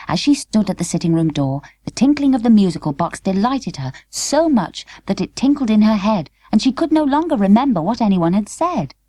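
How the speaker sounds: usual speaking voice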